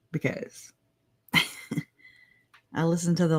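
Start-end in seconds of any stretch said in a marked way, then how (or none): 0.1-1.3 breathy laugh through the word. Real, not acted. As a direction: giggle it out under breath. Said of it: "I'm a dork".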